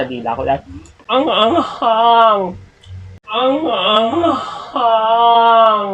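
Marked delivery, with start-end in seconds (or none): 1.1-2.4 slow, high gasp at the heat; drawn out with the tongue burning; mouth burning from spicy food. Real, not acted. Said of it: "My God, ang anghang". 3.2-5.9 very slow, high cry at the heat; mouth burning from spicy food. Real, not acted. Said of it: "My God, ang anghang".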